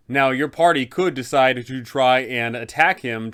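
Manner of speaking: normal talking voice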